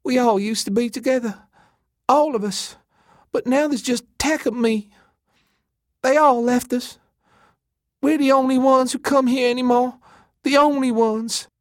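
very high, tearful lament; breaking down. A narrator's reading. Elena grieves being left with Tack, high and despairing. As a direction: wail it as if about to sob. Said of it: "She broke down and started sobbing".

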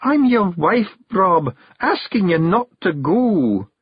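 high, drawn-out Scots entreaty; tearful plea. A narrator's reading. Jeanie asks her husband not to go, tearful. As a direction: beg him, stretching the final words. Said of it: "beginning to cry".

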